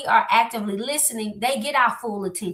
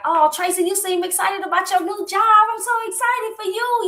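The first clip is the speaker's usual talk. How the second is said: high, bright congratulation, much higher pitch; excited for a friend; 9 semitones higher, 4 dB louder than usual. Shown as a demonstration. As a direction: gush with real excitement for her news. Voicing validation of friend's excitement.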